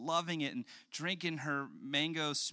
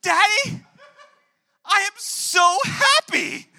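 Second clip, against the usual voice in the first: much higher, far louder and slow, shouting for joy; overjoyed toddler; 20 dB louder, slower than usual. Retold or imitated. Father voicing his daughter shouting how happy she is. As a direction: burst out with joy like a three-year-old.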